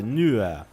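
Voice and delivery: "nur" said gently, gliding into an A; "nur" as a low, smooth "nu-a"; very soft R. Shown as a demonstration. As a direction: let the R of "nur" melt away softly. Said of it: "Bem suave".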